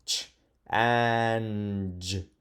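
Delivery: slow sounding out of ch and j; slow phonics sounding out. Shown as a demonstration. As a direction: stretch each sound slowly. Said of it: "Let me see".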